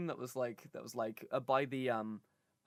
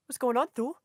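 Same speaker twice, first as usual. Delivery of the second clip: put-on New Zealand accent; 7 semitones higher, 4 dB louder than usual. A mate greets Thor, high-voiced New Zealand accent. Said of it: "he's got a New Zealand accent".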